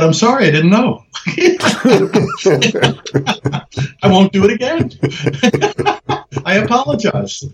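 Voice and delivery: giggling through a fake apology; laughing mock apology. Telling the interviewer about Grad, laughing and mock-contrite. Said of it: "mock apology with laughter".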